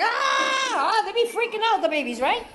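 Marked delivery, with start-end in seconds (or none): 0.0-0.7 high mock scream of a newborn; newborn baby scream. Speaker voicing a newborn baby's panic, high scream. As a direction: shriek like a startled baby. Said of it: "They're like".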